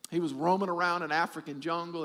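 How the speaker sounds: ordinary talk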